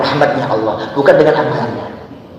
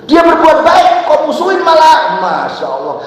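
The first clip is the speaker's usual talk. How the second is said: high, loud fiery preaching; preaching at full force; 4 dB louder than usual. Acted. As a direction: call it out to the whole congregation. Raised preaching. (khotbah berapi-api dengan suara lantang)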